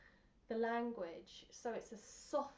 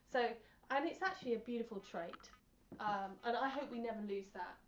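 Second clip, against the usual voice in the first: ordinary talk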